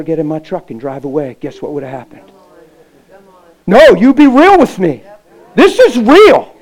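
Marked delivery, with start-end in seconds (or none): 3.7-6.5 louder, higher, slowing on the end; forceful insistence. Real, not acted. Preacher demanding the congregation get real with him. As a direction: drive it home to the crowd. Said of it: "preacher urging congregation".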